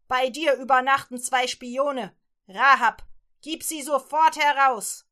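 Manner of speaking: loud barked order at the door; shouting guards. Acted. Guards at night shouting at Rahab through the door, loud. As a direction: bang on the door and yell.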